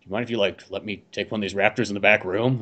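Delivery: low, soft, creepy request; slightly creepy guy asking quietly; kinda creepy. Acted. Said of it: "kinda creepy with those raptors".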